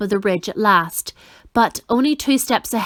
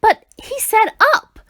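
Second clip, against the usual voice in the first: high, louder bitter protest; bitter complaint; 12 semitones higher, 5 dB louder than usual. A narrator's reading. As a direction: whine it in outrage. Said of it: "Milo complained bitterly".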